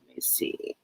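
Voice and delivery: muttering to herself. Real, not acted. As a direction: trail into a whisper while thinking. Muttering to herself while searching.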